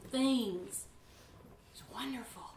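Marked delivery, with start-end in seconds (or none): 1.7-2.4 whisper. Real, not acted. Soft aside after intense lines.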